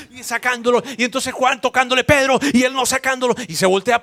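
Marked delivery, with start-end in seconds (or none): 2.1-2.4 high, loud call of a name; urgent call. Retold or imitated. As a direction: call him sharply. Juan calling Pedro.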